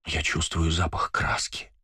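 soft slow whisper. Acted. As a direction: breathe the words slowly. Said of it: "шепчет она".

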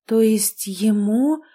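slow, shocked half-question; stunned murmur. A narrator's reading. Heroine learning the old man's age, slow and stunned. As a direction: trail off in disbelief. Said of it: "потрясённо пробормотала".

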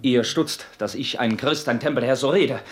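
ordinary delivery

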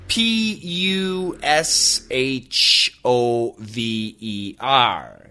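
slow letter by letter spelling; spelled out slowly. Shown as a demonstration. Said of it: "spelling the word".

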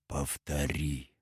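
low, very soft and drawn-out order; barely contained fury. A narrator's reading. Nestor to the frightened radioman, barely holding back fury. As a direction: hold the rage down, slow and quiet. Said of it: "с трудом сдерживает бешенство".

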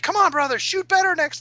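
very high, chirpy kobold encouragement; a little monster cheering on its clumsy ally; high yappy kobold urging its brother to aim better; squeaky kobold. Acted. Kobold cheers the missing archer.

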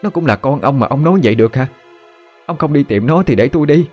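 angry retort. Acted. Wife hitting back at her husband over their son, hurt and angry. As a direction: throw his words back at him.

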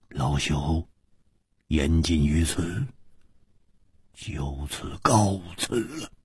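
low, soft, halting farewell; lightly voiced old man. A narrator's reading. Old man taking his leave, soft and measured. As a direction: take formal leave as a quiet elder.

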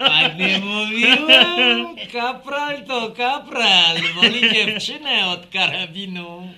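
slow, loud laughing gloat. Acted. Laughing to friends that the corporal chose the girl. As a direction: laugh it out, told you so.

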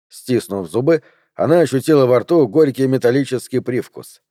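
usual speaking voice

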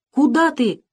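put-on snatch the child back in fright; frightened cry. A narrator's reading. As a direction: grandmother grabbing a girl's hand. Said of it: "испуганно крикнула она".

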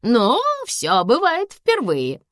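high, slow, drawn-out retort; shrugging. A narrator's reading. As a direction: draw it out with a verbal shrug. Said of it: "пожала плечами мама".

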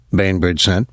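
usual speaking voice

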